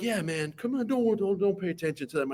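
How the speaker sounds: soft, easygoing reassurance; reassuring. Retold or imitated. Teacher telling the freshman to ignore the seniors, softly. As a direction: wave off the rowdy class gently. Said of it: "you're like".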